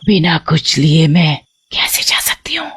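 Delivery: eerie whispered threat; menacing whisper. Acted. Witch to the driver from the back seat, whispering. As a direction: hiss it in his ear from the back seat.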